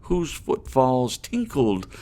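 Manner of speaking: slow, swelling theatrical recitation of a poem; dramatic poem reading. A narrator's reading. Reading The Raven aloud to viewers with dramatic weight. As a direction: declaim the verse, building to anguished cries.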